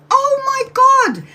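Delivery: high, slow, stunned oh my God; dragged out high-pitched shock; shocked gasp. Retold or imitated. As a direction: recoil in sudden horror at the door. Finds cat in garden.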